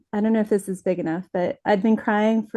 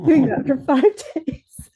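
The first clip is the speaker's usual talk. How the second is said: higher, laughing through the words; 6 semitones higher, 4 dB louder than usual. Real, not acted. Cartoonist admits crying for five days, laughing. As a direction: break into a laugh mid-line.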